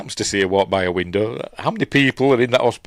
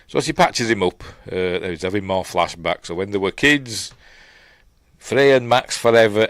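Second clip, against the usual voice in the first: ordinary talk, nothing marked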